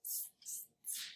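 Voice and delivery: whispered teasing tss tss; monkeys' tss. Acted. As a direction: hiss like cheeky monkeys. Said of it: "the monkeys ... said".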